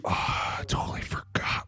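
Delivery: sheepish whispered admission; guilty little whisper. Retold or imitated. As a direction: whisper it like you got caught. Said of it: "the other two were like".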